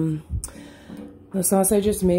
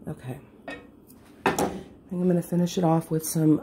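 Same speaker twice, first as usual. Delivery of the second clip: normal talking voice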